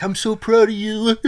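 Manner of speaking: high, loud mock-tearful wobble; trembling voice. Husband telling his wife he's proud, trembling voice. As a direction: imitate yourself choking up with pride. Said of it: "my voice trembling and stuff".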